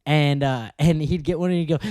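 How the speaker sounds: ordinary talk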